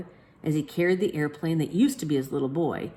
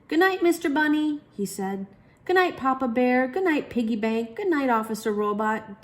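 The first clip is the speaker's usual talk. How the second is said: put-on high, loud sleepy little boy; child voice; 8 semitones higher, 4 dB louder than usual. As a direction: say goodnight like a small child. Child saying goodnights.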